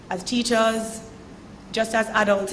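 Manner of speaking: normal talking voice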